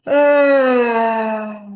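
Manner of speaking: loud, drawn-out releasing sigh; long sigh. Shown as a demonstration. Audible sigh while guiding body awareness. (suspiro longo e sonoro)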